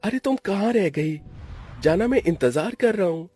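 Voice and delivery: put-on high-pitched young lover on the phone; impatient boyfriend. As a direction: nag your sweetheart lightly.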